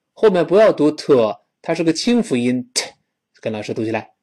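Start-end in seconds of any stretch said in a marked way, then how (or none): none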